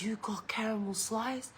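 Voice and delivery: hushed, whispered question; soft, unvoiced-sounding aside to a son; conspiratorial whisper. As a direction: whisper it like a secret find. Secretly spotting sweets in son's room.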